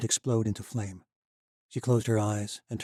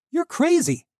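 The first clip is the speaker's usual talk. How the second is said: much higher, loud outburst; shouting; 10 dB louder, slower than usual. Acted. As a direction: throw it at him as a shout. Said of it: "Shouted was more like it".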